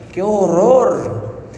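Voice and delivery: slow, drawn-out cry of horror; a slow, appalled gasp of words; horrified exclamation. Real, not acted. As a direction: let the horror stretch out slowly. Reacting to crushing babies.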